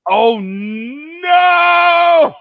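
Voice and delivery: high, loud, drawn-out "oh no"; big embarrassed protest; mock-horrified cry. Real, not acted. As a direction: wail in playful dismay at being found out. Embarrassed at being asked about accent.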